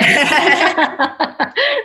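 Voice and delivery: rising high laugh; bright laugh. Real, not acted. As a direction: laugh out loud. Laughing at the joke.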